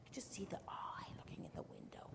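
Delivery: soft whispery pointing-out; hushed aside. Real, not acted. Hushed aside about the picture.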